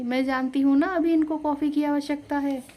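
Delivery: insisting. A narrator's reading. Bride insists on coffee to mother-in-law.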